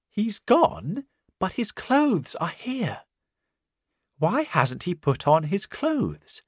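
high, fretting woman's voice; worried landlady. Acted. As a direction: fuss and fret in a high voice. Missus Hall worried, voiced high.

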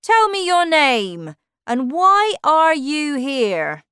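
loud, high, drawn-out interrogation; bossy demand. Acted. Humpty Dumpty questioning a stranger, Alice, bossy, loud and high. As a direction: demand answers like a pompous character.

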